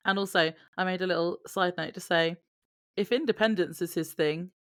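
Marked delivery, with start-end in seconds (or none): none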